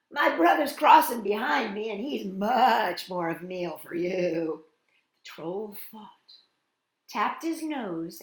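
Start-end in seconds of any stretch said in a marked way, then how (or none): 0.1-4.6 lightly voiced storybook goat; bargaining middle goat. A narrator's reading. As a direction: blurt it out, then coax the troll.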